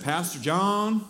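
imitated Southern cowboy greeting; Southern accent. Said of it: "in his best Southern accent".

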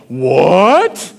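sudden startled yelp; startled what. Retold or imitated. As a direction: caught off guard, blurt it out. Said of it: "I go".

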